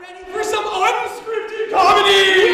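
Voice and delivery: slow, loud, high hype-man shout. Real, not acted. Host hyping the audience at the show start, a slow loud shout. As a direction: whip the crowd up like an announcer.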